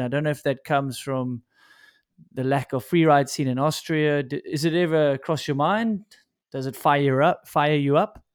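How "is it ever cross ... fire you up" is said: ordinary delivery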